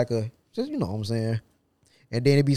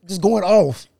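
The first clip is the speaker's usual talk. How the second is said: ordinary delivery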